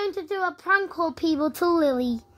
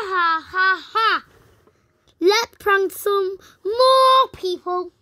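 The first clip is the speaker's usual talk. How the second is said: ordinary talk, nothing marked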